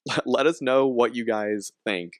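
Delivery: ordinary talk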